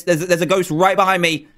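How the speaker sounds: ordinary talk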